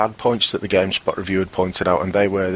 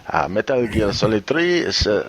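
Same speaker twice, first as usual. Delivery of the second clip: jokey put-on Spanish accent. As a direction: read it in a hammy Spanish accent. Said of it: "Do it in Spanish accent".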